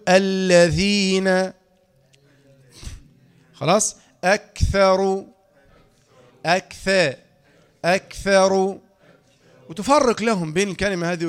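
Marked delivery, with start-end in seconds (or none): 0.1-1.5 "الذين" loud and drawn out, tongue tip at the teeth for ذ; interdental articulation. Shown as a demonstration. Teacher models interdental letters for children, slow "الذين". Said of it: "الحروف اللثوية، آه، تقول". 4.2-8.8 "أكثر" loud and slow, tongue tip at the teeth for ث; interdental articulation. Shown as a demonstration. Teacher drills children's letters, emphatic slow "أكثر". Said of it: "نطق الحرف اللثوي هذا مهم جدًا".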